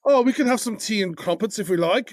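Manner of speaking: high-pitched mock British voice; bad British accent. Co-host joining the tea-and-crumpets joke, mock British. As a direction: play along in a silly British accent. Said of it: "really bad French or British accents".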